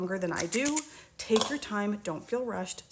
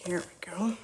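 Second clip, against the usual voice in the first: whispering; 3 dB quieter than usual. Real, not acted. Starting to mix by hand.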